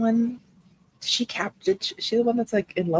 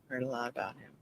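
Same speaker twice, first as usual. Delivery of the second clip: much softer muttered echo; hushed; 6 semitones lower, 18 dB quieter, faster than usual. Acted. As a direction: drop it to a murmur. Repeats it hushed.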